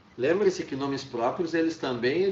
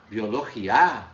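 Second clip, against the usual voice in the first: Biologia stressed on the last A, louder; stress on final A; 3 semitones lower, 4 dB louder, slower than usual. Shown as a demonstration. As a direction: say Biologia as bio-lo-gi-A, questioning. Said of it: "Será que é o biologia?".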